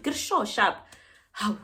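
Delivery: ordinary talk, nothing marked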